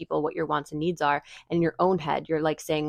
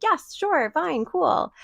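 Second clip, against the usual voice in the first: much higher, bright forced agreement; fake eager yes; 10 semitones higher, much slower than usual. Agreeing to a friend's stay while secretly dreading it, falsely cheerful high yes. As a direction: chirp out a fake happy yes. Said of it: "And you're just like".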